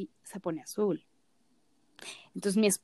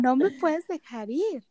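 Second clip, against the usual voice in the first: mock pleading; 8 semitones higher, slower than usual. Repeats her plea, mimicking.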